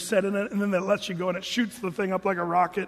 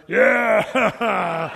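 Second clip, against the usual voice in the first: loud laughing yeah, much louder; dazed laughing yeah; 7 dB louder than usual. Retold or imitated. The guy off the ride, giving a loud laughing yeah. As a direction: laugh it out, shaken but relieved. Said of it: "after he came to".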